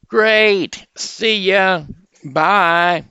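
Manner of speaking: high, loud, booming big-mouth frog; high, loud frog shouting hello to the rabbit. Acted. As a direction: shout the greeting like a proud frog. Frog says goodbye.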